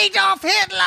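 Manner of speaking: high, hammed-up name shout. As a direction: shout it like a hype man's tag. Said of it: "He says his name like [name]".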